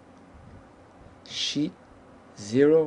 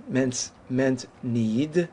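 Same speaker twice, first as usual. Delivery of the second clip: ordinary talk, nothing marked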